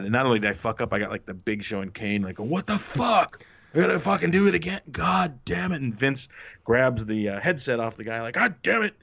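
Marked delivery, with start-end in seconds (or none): 2.4-5.8 exasperated big man having to redo the stunt; frustrated curse, mock angry; annoyed wrestler. 8.3-8.9 much higher exasperated curse; mock boss frustration; irritated boss. Vince grabs headset, angry.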